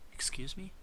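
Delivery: high, startled question; as if he misheard; father caught off guard by the professor; stunned father. Acted.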